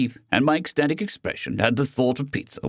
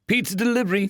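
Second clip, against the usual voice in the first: delivery voice; 10 semitones higher than usual. Acted. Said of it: "came a voice from the other side".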